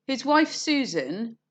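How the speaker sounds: slow, with clear pauses before and after "Susan"; pauses round Susan. Shown as a demonstration. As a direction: set "Susan" apart with little pauses, like commas. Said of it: "the name Susan is in an embedded clause".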